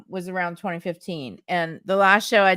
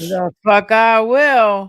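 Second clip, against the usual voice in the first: slow, louder relish of the line; savoring repeat; 3 semitones higher, 8 dB louder, slower than usual. Acted. Friend repeating the refusal for laughs, slow and relished. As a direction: echo the punchline with relish.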